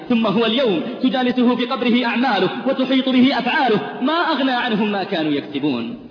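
full-force sermon delivery; fiery preaching. Acted. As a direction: thunder the warning to the congregation. Sermon climax.

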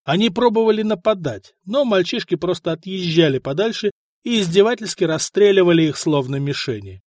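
plain reading voice

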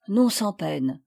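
ordinary delivery, nothing marked

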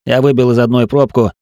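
ordinary delivery

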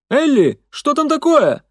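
high, loud call through the house. A narrator's reading. Слуга окликает из дома.

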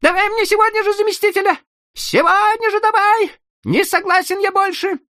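very high, loud, fast squeal; squealing hysterical official; shrieking. Acted. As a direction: shriek the demand in panic. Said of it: "завизжал".

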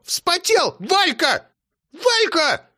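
high shrieking call of a grandma; grandma hollering for Valya; grandma yelling. A narrator's reading. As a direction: yell for her daughter. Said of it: "заорала баба Сима".

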